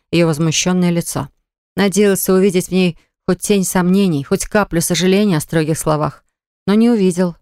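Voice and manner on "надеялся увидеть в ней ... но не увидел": ordinary delivery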